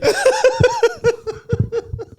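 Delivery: high, loud, helpless cackling; loud laughing fit. Real, not acted. Comic losing it over the gig photo, loud laughing fit.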